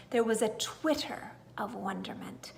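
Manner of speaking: usual speaking voice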